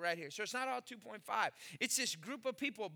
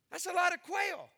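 emphatic; 7 semitones higher, 3 dB louder than usual. Real, not acted. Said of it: "repeated for emphasis".

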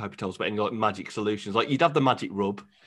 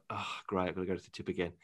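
quiet grumble; 5 semitones lower, 11 dB quieter, faster than usual. Retold or imitated. Grumbling about tip trips.

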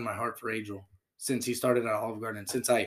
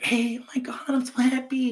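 high, bubbly mock manager voice; excited manager; 10 semitones higher, 3 dB louder than usual. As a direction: play the gushing boss, pitched way up. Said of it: "she was like".

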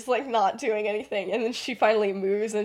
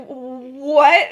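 loud, higher rising "What?"; stunned exclamation; 4 semitones higher, 9 dB louder than usual. Retold or imitated. Lily after holding her breath, stunned exclamation. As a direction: blurt it out in acted disbelief.

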